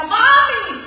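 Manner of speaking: put-on high, loud child's cry, drawn out; child's yell. Child calling mom for help, high loud cry. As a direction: call for mom like a stuck kid. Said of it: "cuando ella te grita".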